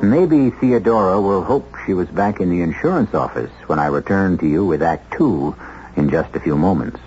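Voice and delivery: normal talking voice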